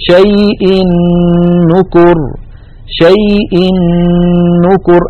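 شيء نكر recited with the tanween doubled into the نّ of نكر; chanted "shay-in-nukur" with the nun held and nasal; tanween merged into nun. Shown as a demonstration. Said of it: "أضغم التنوين في النون من نكر".

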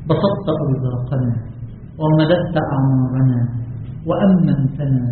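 slow, drawn-out, low devotional voice; supplication prayer. Real, not acted. Preacher leading closing prayer to God, hushed supplication. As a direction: pray slowly, stretching each phrase end.

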